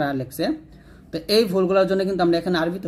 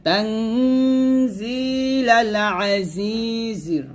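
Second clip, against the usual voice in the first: slow drawn tajweed chant; Quran recitation; 4 semitones higher, much slower than usual. Shown as a demonstration. Teacher reciting for students to follow. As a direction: recite slowly, stretching each vowel.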